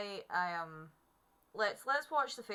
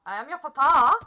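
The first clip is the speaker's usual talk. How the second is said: loud, hammed-up declaration to a horse; playful parental outburst; mock insistent shout; 4 semitones higher, 17 dB louder than usual. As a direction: proclaim it loudly, stretching the end.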